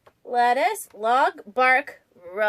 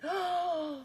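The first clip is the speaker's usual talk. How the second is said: gasp; 3 semitones higher, 8 dB quieter than usual. Acted. Reader reacts to bunny reveal.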